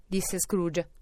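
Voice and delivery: plain reading voice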